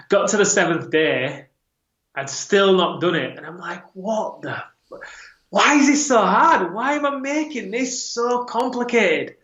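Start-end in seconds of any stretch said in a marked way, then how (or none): 3.9-9.3 frustrated. Retold or imitated. Frustrated inner voice.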